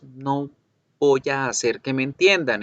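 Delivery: normal talking voice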